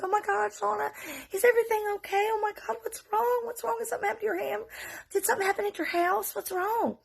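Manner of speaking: high-pitched, fretful questioning; worried mother. Retold or imitated. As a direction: imitate a wife fussing over a child. Worried wife fusses over child, retold.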